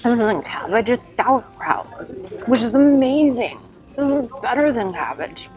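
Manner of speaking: rising with joy, thanking Mom; delighted gush. Real, not acted. Vlogger thrilled by dumplings like Mom's, gushing excitedly. Tastes the dumpling she has missed.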